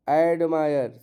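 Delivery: ordinary talk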